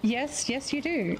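ordinary talk, nothing marked

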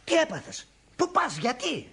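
high, startled questions; alarmed questioning. Acted. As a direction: call after a friend in alarm. Friend suddenly leaves, he is alarmed. (ξαφνιασμένες, ανήσυχες ερωτήσεις)

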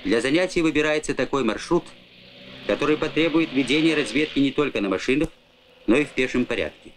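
normal talking voice

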